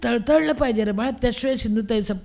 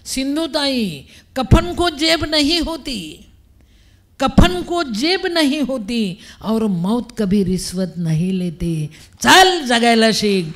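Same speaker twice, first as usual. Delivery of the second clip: slow, ringing proclamation with long vowels; drawn-out, declaimed divine voice; voice from the heavens; 2 semitones higher, 5 dB louder, slower than usual. Retold or imitated. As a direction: proclaim it like an oracle, building to a loud command. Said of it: "Akashvani झाल्यासारखा आवाज आला".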